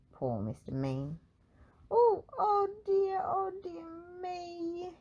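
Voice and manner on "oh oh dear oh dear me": a high comic wail, pitched well up. Acted. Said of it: "he wailed".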